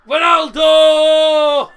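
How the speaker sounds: long joyful goal shout. Real, not acted. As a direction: roar the name out.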